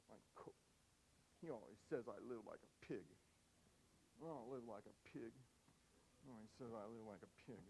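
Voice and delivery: put-on low, soft grumbling boy; a sulky kid's low grumble; sulking kid. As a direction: mutter like a hurt eight-year-old. Sulky eight-year-old voiced.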